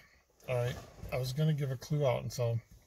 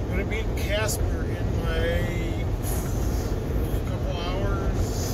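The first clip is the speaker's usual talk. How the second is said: lightly held put-on voice; 3 semitones higher, 5 dB louder than usual. Vlogger signing off to viewers from Wyoming, lightly in a put-on voice. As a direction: keep the odd voice going, lightly. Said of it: "I don't know why I'm talking like this".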